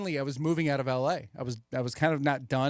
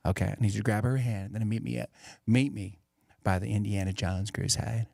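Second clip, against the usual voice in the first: McConaughey impression; 5 semitones lower than usual. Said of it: "Where he's like".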